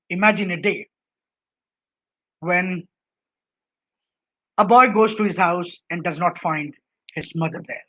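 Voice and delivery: hushed and low, letting "when" hang alone before continuing; dramatic pauses. Shown as a demonstration. Lecturer demonstrating pauses to a public speaking class, with dramatic pauses. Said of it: "how will I use pause here".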